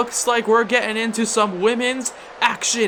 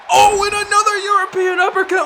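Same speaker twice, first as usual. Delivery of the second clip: higher, louder, quick burst at the uppercut; excited shout; 9 semitones higher, 4 dB louder, faster than usual. Acted. Commentator hyping the second uppercut, excited shout. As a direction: yell it out as the hit lands. Big move hits.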